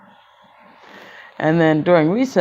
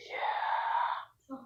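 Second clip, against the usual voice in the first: awed; 15 dB quieter than usual. Acted. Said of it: "the whole class goes".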